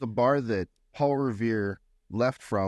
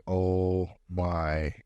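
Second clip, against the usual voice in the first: very slow, stretched-out "Oh my God"; aghast drawl; 3 semitones lower, much slower than usual. Retold or imitated. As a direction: draw every word out in mock disbelief. Bartender's aghast reaction, drawn out.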